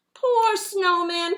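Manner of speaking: put-on children mourning the snowman; children's cry. A narrator's reading. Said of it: "cried the children".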